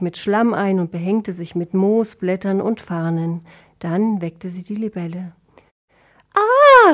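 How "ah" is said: very high, loud shriek; frightened high yelp; startled scream. A narrator's reading. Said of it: "rief Klee erschrocken".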